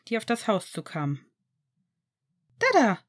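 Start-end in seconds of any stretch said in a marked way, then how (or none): 2.6-3.0 put-on high, loud toddler cheer; a toddler squealing with delight as Dad arrives; joyful squeal. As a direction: cheer it like a happy two-year-old. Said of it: "jauchzte der Zweijährige fröhlich".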